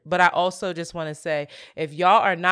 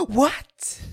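mock startled question, pitched up; startled awake; 5 semitones higher than usual. Imitating a friend woken mid-snore, startled. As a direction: imitate a sleeper jolted awake. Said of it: "she shoots up and she goes".

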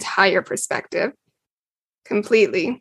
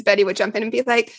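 ordinary talk

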